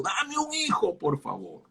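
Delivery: high, breaking plea that sinks to almost nothing; a mother begging God through tears; tearful plea. Acted. Ana begs God for a son, voice breaking then fading. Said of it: "Así habrá llorado".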